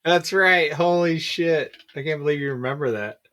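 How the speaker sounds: normal talking voice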